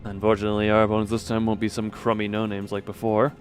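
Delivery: ordinary talk, nothing marked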